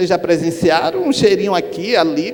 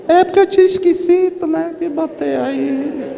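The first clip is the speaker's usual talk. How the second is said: sheepish woman; 11 semitones higher than usual. Retold or imitated. Embarrassed woman explains, voiced high.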